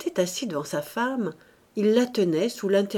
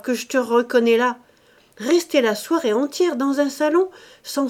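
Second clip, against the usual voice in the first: higher, louder shouting at his wife; exasperated husband shouting; 7 semitones higher, 6 dB louder than usual. Acted. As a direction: call it out loud and triumphant from across the room. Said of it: "il cria à Marthe".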